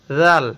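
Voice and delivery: জাল with the tongue tip out, soft; soft Arabic dhal. Shown as a demonstration. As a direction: put the tongue between the teeth for জাল. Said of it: "জিহ্বাটা বের করে দিব".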